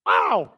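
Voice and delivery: loud mock yelp of a jabbed ox; bad ox scream. Preacher imitating a jabbed ox, loud mock yelp. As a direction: yelp sharply like a prodded ox. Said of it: "so that was a very bad impression".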